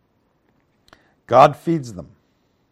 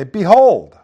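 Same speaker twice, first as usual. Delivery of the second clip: "behold" loud and raised in pitch; commanding, high, loud "behold"; emphatic; 7 semitones higher, 12 dB louder than usual. Shown as a demonstration. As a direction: hit "behold" hard to make them pay attention. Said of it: "It's emphatic. It's pay attention".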